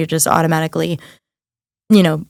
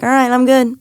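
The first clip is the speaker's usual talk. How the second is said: higher, sarcastically easygoing; mock body voice; 6 semitones higher than usual. Mocking the idea that a few breaths fix everything, sarcastically. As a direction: play the body pretending it's instantly fine. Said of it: "your body's like".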